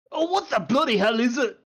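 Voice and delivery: a disturbed dwarf yelling through the door; grumpy dwarf. Acted. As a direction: Doran roaring at the knock. Dwarf woken at his door.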